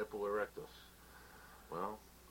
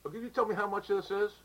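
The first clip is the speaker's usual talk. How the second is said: fussy customer voice; 8 semitones higher than usual. Acted. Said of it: "the guy's giving him a hard time".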